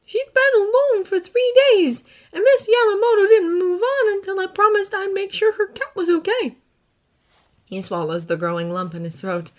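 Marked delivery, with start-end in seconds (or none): 0.0-6.6 put-on earnest boy, gently recounting; young hero voice. Izuku telling his story.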